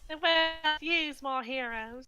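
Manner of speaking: a pompous British hero declaring it slowly; accidental accent. Pretend. Player slipping into a British hero accent mid-intro. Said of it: "I don't know why that accent happened".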